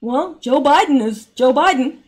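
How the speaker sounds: slightly mocking politician voice; Nancy Pelosi impression. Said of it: "her words were, and I quote".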